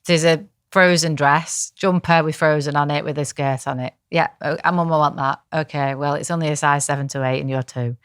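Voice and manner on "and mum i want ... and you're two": ordinary talk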